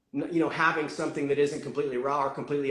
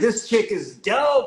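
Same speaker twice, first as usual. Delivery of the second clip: excited; 5 semitones higher, slower than usual. Retold or imitated. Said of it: "I was like".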